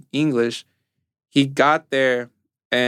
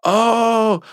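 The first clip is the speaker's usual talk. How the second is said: high, fluttery, embarrassed; mock-flustered sorry, pitched up; retelling the worker's panicked apology; 5 semitones higher, slower than usual. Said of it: "And he was like".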